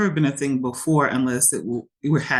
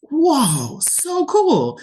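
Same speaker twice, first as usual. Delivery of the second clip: high, wide-eyed gasp of delight; thrilled child voice; excited kid; 12 semitones higher, 3 dB louder, much slower than usual. Retold or imitated. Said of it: "my daughter was like".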